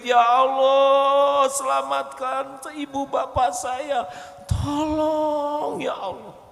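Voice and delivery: high, slow anguished cry to God; crying out in prayer. Acted. Worshipper crying to God to save parents, high slow anguished wail. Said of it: "Kapan kita menjerit".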